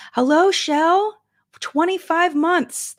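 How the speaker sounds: high, slow, drawn-out hello; sing-song greeting. Real, not acted. Streamer sing-songing thanks to a member in chat. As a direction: stretch it out sweetly.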